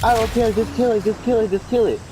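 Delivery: high insistent mom; high nagging mom. Retold or imitated. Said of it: "She was like".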